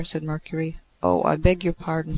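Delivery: plain reading voice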